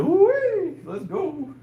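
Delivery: high, slow pumped-up cheer; drawn-out victory hype after a lift. Real, not acted. Repeats his lift cheer.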